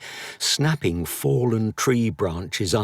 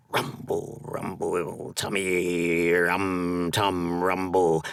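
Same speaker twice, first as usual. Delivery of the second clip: stomach rumble; 3 semitones lower, slower than usual. A narrator's reading.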